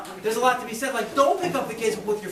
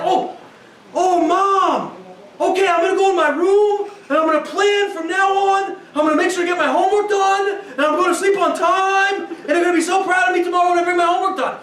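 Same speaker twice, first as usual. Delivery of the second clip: high, louder, sugary promise to Mom; mock obedient child; 4 semitones higher, 5 dB louder than usual. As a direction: ham up the perfect kid who promises everything. Mocking an idealized obedient child.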